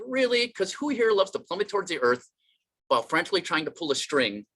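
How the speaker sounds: high-pitched mock disbelief; incredulous. Comedian challenging a clichéd condolence, incredulous and high. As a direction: question it as if it's absurd.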